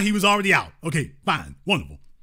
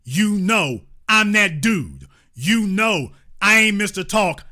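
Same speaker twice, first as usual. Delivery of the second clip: ordinary talk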